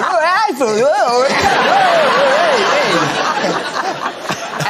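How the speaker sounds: loud, high Cockney wail with no consonants; Cockney all vowels. Acted. Actor hamming up Cockney for laughs, shouted consonant-free drawl. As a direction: smear it into one long Cockney vowel. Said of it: "one long con-, uh, vowel. No consonants".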